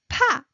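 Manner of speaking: 파 aspirated, high and loud; 파 with a strong puff of air. Shown as a demonstration. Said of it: "stronger one, rising".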